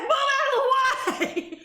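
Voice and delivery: high, slow laughing yell; frantic yell. Retold or imitated. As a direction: shove him aside with a laughing shout. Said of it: "And I'm like".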